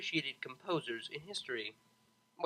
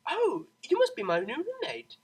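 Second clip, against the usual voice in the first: brightened; 11 semitones higher, 6 dB louder than usual. A narrator's reading. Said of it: "her demeanor brightened".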